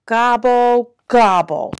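loud, grumbled turkey gobble; mock-grumpy turkey, punched out; grumbling gobble. A narrator's reading. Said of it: "grumbled Turkey".

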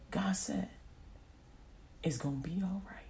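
low, hushed reassurance; comforting whisper. Retold or imitated. Messenger telling a crying person, whispered comfort. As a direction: whisper it gently to someone weeping. Stranger passing on God's comfort.

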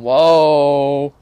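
loud startled whoa; surprised whoa. Real, not acted. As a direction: caught off guard by the gust. Sudden gust of wind.

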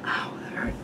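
breathy pained whisper. Real, not acted. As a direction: whisper it, wincing. Pained aside after an ow.

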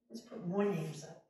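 usual speaking voice

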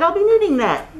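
high, cheeky leprechaun; high little man voice. Acted. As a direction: pitch it up, mischievous.

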